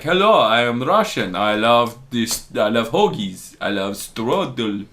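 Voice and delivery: slow, hammed-up Russian accent; bad Russian accent. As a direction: drag the words out in a fake Russian accent. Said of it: "talk like this in a really bad Russian accent".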